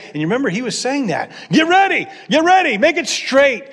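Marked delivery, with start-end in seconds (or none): 1.5-3.6 high, loud herald's cry. Retold or imitated. John the Baptist crying out to the people, loud and high. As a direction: call it out like a prophet in the desert.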